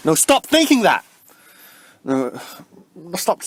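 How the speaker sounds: high, loud cry of no; sharp self-scolding. Real, not acted. Cutting off her own dark thought with a high, loud cry. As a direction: snap at yourself to stop the thought.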